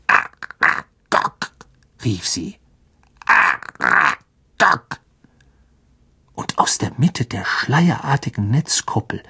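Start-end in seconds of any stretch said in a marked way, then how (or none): 0.1-2.6 slow, whispery spider call with clicked syllables; clicking spider. A narrator's reading. A giant spider summoning its elder, slow clicking creature voice. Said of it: "klickte bei jedem Wort mit ihren Greifzangen". 3.3-5.0 slow, broken creature call, syllables far apart; clicking spider. A narrator's reading. A giant spider calling into the web dome, slow clicking spider voice. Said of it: "klickte bei jedem Wort mit ihren Greifzangen".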